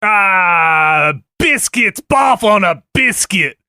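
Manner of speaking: over-the-top bad Australian exclaiming; Australian accent. Co-host trying his best Australian on request, Australian accent. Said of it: "Give me your best Australian".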